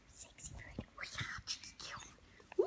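very soft breathy tease; teasing whisper. Real, not acted. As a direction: whisper it cheekily to the bird. Teasing the parrot.

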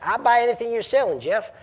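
put-on friendly Southern accent on a high voice. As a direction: imitate a Southern retailer in a Southern accent. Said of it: "here comes my southern accent".

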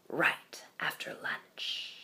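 slow, soft whisper; snake whisper. Acted. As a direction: draw it out in a hushed whisper like a snake. Snake adds a threat.

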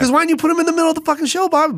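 fast, high-pitched mock outrage; indignant complaint. Host scolding the show's owner, rapid indignant complaint.

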